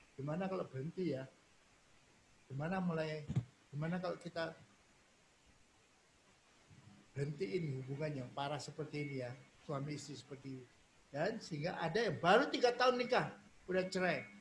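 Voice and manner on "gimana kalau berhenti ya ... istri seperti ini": low, soft wondering aloud; quiet musing. Acted. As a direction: muse to yourself about quitting, hushed. Spouses privately consider divorce. (bergumam pelan, merenung)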